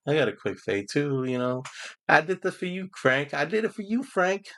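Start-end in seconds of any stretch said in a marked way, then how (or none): 2.1-4.5 put-on high, sweet child's voice; high mock little girl voice. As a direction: play the little girl giving Frank her drawing.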